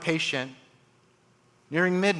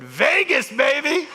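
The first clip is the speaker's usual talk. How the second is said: loud, much higher gleeful cry; excited whoop; 11 semitones higher, 5 dB louder, slower than usual. Retold or imitated. Grinning grandmother tells the surgeon her plan, gleeful whoop. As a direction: shout it like a party.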